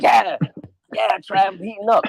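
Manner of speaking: lightly put-on, high-pitched hype; mock hype voice. Mocking the man's game to friends, high-pitched put-on voice.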